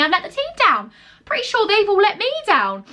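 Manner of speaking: high-pitched indignant comeback; retold indignation. Employee retorting to manager about the team, retold indignation. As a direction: re-enact throwing the accusation back, pitch climbing.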